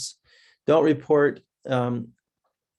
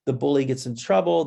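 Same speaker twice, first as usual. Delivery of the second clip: ordinary talk, nothing marked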